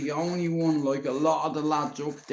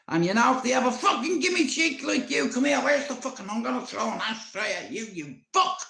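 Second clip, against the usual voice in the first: granny ranting; 5 semitones higher than usual. Retold or imitated. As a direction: threaten him like a raging granny. Granny rant threatening ashtray.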